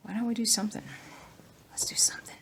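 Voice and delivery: whispered plea, barely voiced. Real, not acted. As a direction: drop to a breathy whisper, urging. Sudden whisper.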